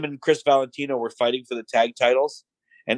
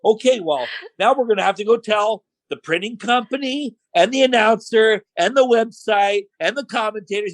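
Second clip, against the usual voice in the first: sarcastic piling-on; 9 semitones higher than usual. Retold or imitated. Boss piles on sarcastic list.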